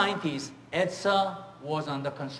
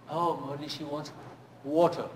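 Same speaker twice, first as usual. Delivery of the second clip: usual speaking voice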